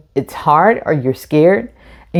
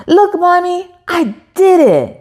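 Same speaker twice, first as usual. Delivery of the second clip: put-on much higher, childlike voice; proud little boy; 10 semitones higher than usual. A narrator's reading. Isaac showing Mommy he opened the ice cream, proud child voice. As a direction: chirp it like a proud small kid. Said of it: "he says".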